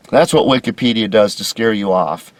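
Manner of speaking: usual speaking voice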